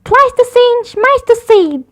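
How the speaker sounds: high, loud, slow shout; a little cub yelling at her brother. A narrator's reading. Sister shouting at Brother during chores, loud. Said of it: "shouted Sister".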